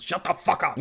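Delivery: real irritation at the trucks; irritated outburst. Real, not acted. Yelling at loud trucks outside.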